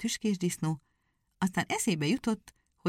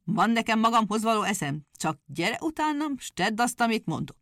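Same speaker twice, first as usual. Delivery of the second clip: louder, higher, scolding husband; angry hedgehog; 4 semitones higher, 4 dB louder than usual. Acted. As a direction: cut her wailing short. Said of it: "rákiáltott a feleségére".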